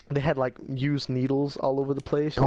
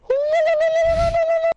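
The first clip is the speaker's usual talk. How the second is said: mock whoop; 8 dB louder than usual. Imitating the man's whooping cry.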